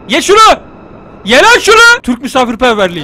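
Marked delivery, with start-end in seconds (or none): 0.1-2.0 loud, high shouted order; bully them into eating, in mock fury; mock-angry command. Player forcing food on a game guest, mock-angry shout. Force-feeding game character kebab.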